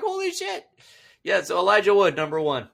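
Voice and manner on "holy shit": shocked. Retold or imitated. Retold shock.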